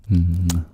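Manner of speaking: loud "mwah" kiss; affectionate smooching mwah; kiss sound. Pretend. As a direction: blow the listener an audible kiss. Blown kiss after thanking viewer.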